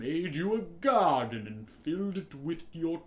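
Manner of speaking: slow, booming voice of God from above. Retold or imitated. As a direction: boom it out like a stern deity. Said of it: "the booming voice of fucking God".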